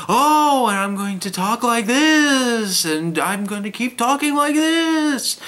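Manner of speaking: loud, high, swooping mock narrator; John Glover mock impression. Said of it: "up and down in pitch".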